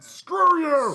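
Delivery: high, loud, drawn-out imitation yell; stretched-out hammed-up shout; mock furious shout. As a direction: holler it like an angry movie character. Acted shouted insult in film retelling.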